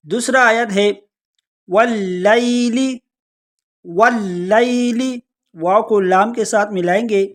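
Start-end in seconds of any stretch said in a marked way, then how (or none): 1.7-5.2 واللیل drawn out slowly with a jolted, doubled ل; doubled lam. Shown as a demonstration. Teacher showing the tashdid to students, slow recitation. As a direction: hit the ل of واللیل with a jolt, slowly. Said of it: "لام کو تھوڑا جھٹکے کے ساتھ پڑھیں گے واللیل".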